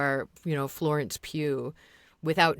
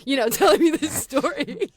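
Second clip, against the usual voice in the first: higher and louder, stretched-out mock excitement; imagined gushing fan; 6 semitones higher, 6 dB louder than usual. Fan projecting onto an online creator, mock gushing. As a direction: imitate a fan, voice climbing to the end. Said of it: "people are, like, projecting".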